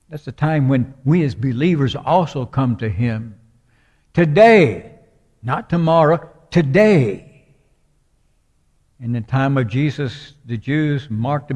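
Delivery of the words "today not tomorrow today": "Today" punched higher and louder both times; emphatic today. Real, not acted. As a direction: hit each "Today" hard. Preacher hammers the word today.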